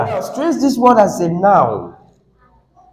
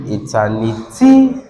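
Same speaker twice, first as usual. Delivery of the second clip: "eternity" low until a raised, held final syllable; stress on last syllable; 8 semitones lower than usual. Shown as a demonstration. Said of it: "So what's the sylla".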